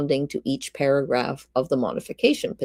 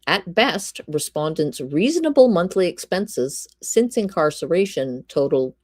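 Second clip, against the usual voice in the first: ordinary talk, nothing marked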